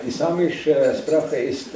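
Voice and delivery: normal talking voice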